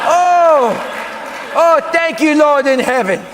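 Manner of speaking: high, loud, slow cry of relief; hammed-up overjoyed relief. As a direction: thank heaven at full volume. Relief letter was lost.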